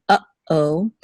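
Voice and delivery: uh-oh with a clear catch in the middle; pause between syllables. Shown as a demonstration. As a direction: break uh-oh after the uh. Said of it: "there's a pause between uh".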